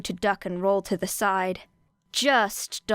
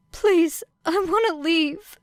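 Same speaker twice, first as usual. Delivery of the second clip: faked pleading whimper; 9 semitones higher, 4 dB louder, slower than usual. Girl fakes distress to officer.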